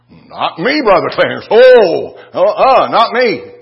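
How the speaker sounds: high, loud, whiny protest of innocence; mock defensive denial. Churchgoer squealing a denial at the preacher. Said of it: "If I was to come to you and say".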